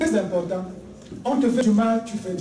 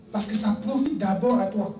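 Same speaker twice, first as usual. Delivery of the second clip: ordinary delivery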